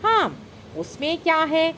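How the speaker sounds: a touch of playful imitation; mimicking younger brother. A narrator's reading. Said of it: "നട്കഡ് കെ ശബ്ദോങ്കി നക്കൽ".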